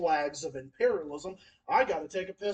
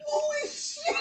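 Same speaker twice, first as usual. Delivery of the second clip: much higher squeal dissolving into laughter; shocked laughter; slower than usual. Real, not acted. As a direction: squeal it out, then crack up. Reacting to violent rant, laughing.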